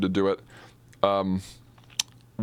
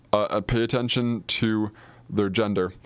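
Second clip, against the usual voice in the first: usual speaking voice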